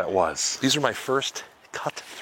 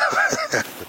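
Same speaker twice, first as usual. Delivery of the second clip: laugh. Real, not acted. Laughs at own joke.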